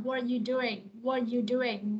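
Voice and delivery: "are" weakened to a schwa in "what are you doing"; reduced vowel. Shown as a demonstration. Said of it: "nó sẽ đọc thành ơ". (đọc "are" thành "ơ" trong "what are you doing")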